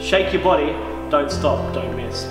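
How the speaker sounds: ordinary talk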